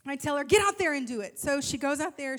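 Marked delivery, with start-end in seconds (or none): 0.5-1.4 louder, mildly mock yell; retold yelling. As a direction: order her out there loudly. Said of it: "I try not to yell at her".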